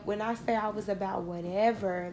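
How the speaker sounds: ordinary talk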